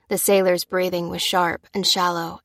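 usual speaking voice

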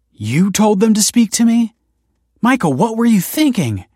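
high, loud, incredulous confrontation; indignant. A narrator's reading. Wife confronting her husband for meddling, indignant.

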